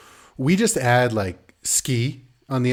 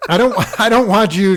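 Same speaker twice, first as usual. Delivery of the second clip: higher, louder spluttering protest; mock exasperated protest; 9 semitones higher, 8 dB louder than usual. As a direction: protest through amusement, flustered. Acted protest to intruding kid.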